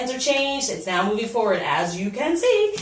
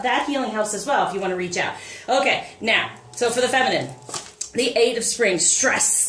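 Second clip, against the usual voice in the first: ordinary talk, nothing marked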